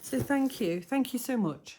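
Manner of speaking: real gratitude welling up; tearful, emotional thanks. Real, not acted. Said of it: "Sorry, I get a bit emotional again".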